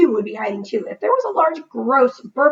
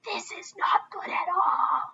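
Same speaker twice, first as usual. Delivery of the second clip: unvoiced whisper; 10 semitones higher, 7 dB quieter, slower than usual. A narrator's reading. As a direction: keep it hushed and scared. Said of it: "whispered Desmond".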